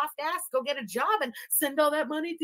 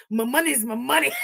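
laughing mimic; 3 dB louder than usual. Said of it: "Everything he does".

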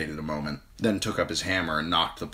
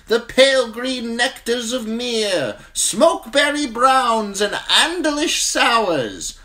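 high, loud, slow hawker's voice; eager wine merchant; 9 dB louder, slower than usual. A narrator's reading. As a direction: sell it to the crowd.

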